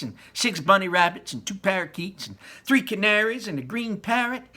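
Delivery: high, loud whiny brat listing her pets; a spoiled girl stamping her foot for what she wants; spoiled demanding girl. Acted. Veruca's demanding speech.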